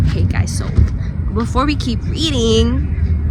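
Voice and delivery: loud call across the house, slowed. Real, not acted. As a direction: call out loudly so the family hears. Calling out to family before eating.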